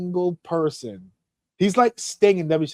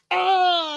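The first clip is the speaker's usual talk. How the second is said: very high, drawn-out mock yell; imitated wrestler yell. Retelling wrestlers trading blows, high mock yell. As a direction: hold a high yell at talking volume.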